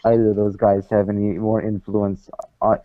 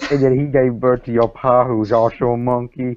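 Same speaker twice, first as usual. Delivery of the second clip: put-on Southern accent. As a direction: preach it like a hammed-up Southern creationist. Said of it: "You should work on your accent".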